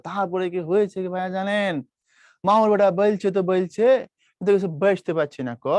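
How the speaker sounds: retelling a Rajshahi man in his dialect; a lightly voiced Rajshahi accent. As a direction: chat like a Rajshahi local. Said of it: "এক সময় সে বলতেছে".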